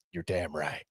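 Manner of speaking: low, hushed creepy growl of agreement; creepy coach voice. Retold or imitated. Host slipping into old football coach's voice, creepy and low. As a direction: drop it low, soft and creepy. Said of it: "that was a little creepy how I said".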